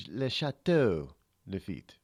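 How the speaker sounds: "Le Chateau Lafitte" again with a light put-on French accent; mock French. Shown as a demonstration. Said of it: "just say it in French".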